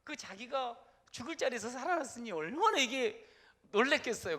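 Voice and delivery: usual speaking voice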